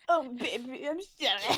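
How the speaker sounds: playfully overdone lovey apology; a laughing, hammed-up lover saying sorry; gushing lover. Acting out the play's energy.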